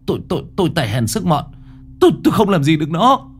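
stammering, fast and high; hammed-up fright. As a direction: fake terror urging him to pay. Fake terrified plea.

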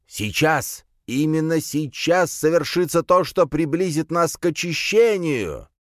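high, fervent proclamation; cult leader preaching. A narrator's reading. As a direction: rouse the crowd for the ritual. Cult leader announces the ritual. (пылкая высокая речь главаря секты)

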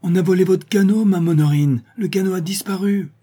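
the sailor bursts in shouting the news; panicked sailor shouting high and loud. A narrator's reading.

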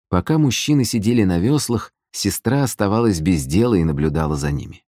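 ordinary delivery, nothing marked

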